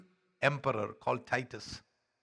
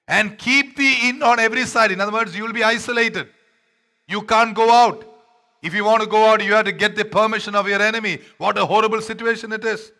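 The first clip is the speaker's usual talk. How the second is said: full-force preaching; 7 semitones higher, 11 dB louder than usual. Acted. Pastor warning congregation, loud high preaching.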